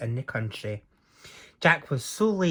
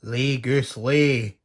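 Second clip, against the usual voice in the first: crying out; 5 dB louder, much slower than usual. Acted. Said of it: "he cried".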